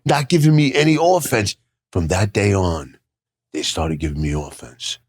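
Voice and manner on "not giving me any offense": high, mock-accusing jab; teasing jab. Ribbing the other wrestlers about offense, high and teasing.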